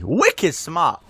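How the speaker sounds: attempted Boston accent on wicked smart; a weak stab at Boston, wicked smart. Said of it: "I can't do a Boston accent".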